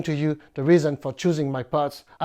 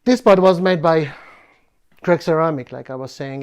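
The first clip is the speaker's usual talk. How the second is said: normal talking voice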